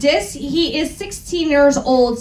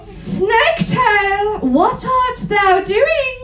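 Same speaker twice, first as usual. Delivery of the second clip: slow, higher mock-theatrical call; drawn-out old-fashioned cry; 6 semitones higher, slower than usual. Voldemort calling out to Snaketail, slow mock-dramatic. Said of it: "called Voldemort".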